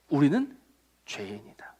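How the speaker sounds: very soft, low near-whisper; hushed admission. Acted. Preacher telling congregation they are sinners, hushed admission. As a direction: let it drop almost to nothing. Solemn statement of being sinners.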